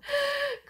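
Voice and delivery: sudden high-pitched embarrassed giggle. Real, not acted. Embarrassed over misreading a name. (tawa kecil bernada tinggi)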